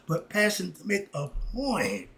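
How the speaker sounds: ordinary delivery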